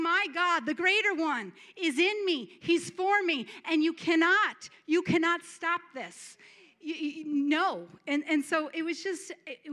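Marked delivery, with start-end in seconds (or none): 0.0-6.4 louder, higher bold declaration to the devil; defiant proclamation. Acted. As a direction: proclaim triumph over the enemy.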